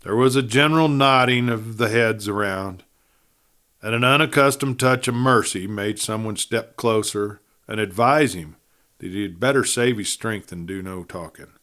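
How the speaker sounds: plain reading voice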